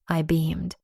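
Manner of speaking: usual speaking voice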